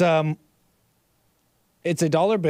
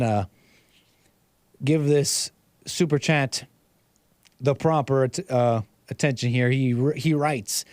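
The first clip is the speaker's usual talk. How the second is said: ordinary talk, nothing marked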